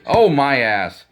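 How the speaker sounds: a hammed-up grumble, drawn out; mild exclaim. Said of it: "I'm going".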